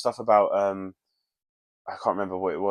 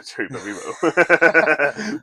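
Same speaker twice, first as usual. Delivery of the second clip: laughing; 5 semitones higher, 7 dB louder, much slower than usual. Real, not acted. Laughing about quotes to come.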